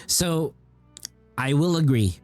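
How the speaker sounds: normal talking voice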